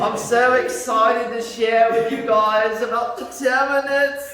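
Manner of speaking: high, loud, drawn-out English accent; excited English accent. Shown as a demonstration. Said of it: "lecture in an English accent".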